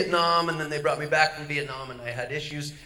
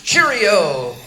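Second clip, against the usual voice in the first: a light mock posh English accent, louder; bad English accent; 9 dB louder than usual. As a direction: toss off a cartoon British goodbye. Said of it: "Here's my English".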